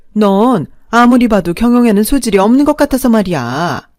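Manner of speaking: mother-in-law grabbing the restaurant, loud and condescending. Acted. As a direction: lecture her like she's useless.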